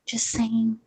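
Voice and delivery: whispered, imitating a calm arguer; mock hushed voice. Mocking how opponents hush their voice in arguments, whispered. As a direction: breathe it out in a hush, mocking them. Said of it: "their voice comes down low".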